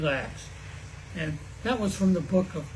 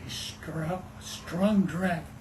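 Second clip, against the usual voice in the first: usual speaking voice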